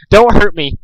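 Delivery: high, loud frightened plea; flinching plea. A narrator's reading. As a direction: shrink away and beg. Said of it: "he flinched".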